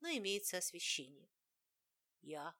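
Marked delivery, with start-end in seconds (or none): 2.2-2.5 lightly voiced hoarse creature; a hint of a hoarse inhuman rasp. A narrator's reading. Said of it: "ответил хриплый нечеловеческий голос".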